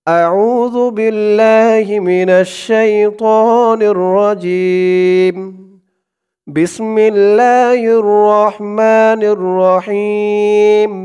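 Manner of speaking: slow, drawn-out chanted recitation; Quran recitation. Shown as a demonstration. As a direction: intone each word slowly like tilawat. (ধীর সুরেলা তিলাওয়াত)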